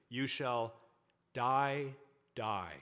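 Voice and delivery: DIE said twice, loud then dropping; emphatic repetition. Shown as a demonstration. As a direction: land hard on each "die", with a pause between. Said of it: "They repeat the word twice when they're really emphasizing".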